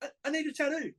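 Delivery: high, pleading mock whine; mock a needy client begging; whiny customer.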